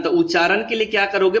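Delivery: normal talking voice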